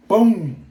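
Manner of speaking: loud, sudden mock gunshot; imitated gunshot boom. Vlogger acting out the gun firing, a loud mock boom. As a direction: bark out the boom like a gun going off.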